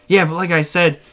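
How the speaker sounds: put-on eager stranger voice; Frankie. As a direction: imitate a guy pushing his truck makeover. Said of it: "And he goes".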